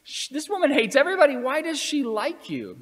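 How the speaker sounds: incredulous peers. Retold or imitated. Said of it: "they would be like".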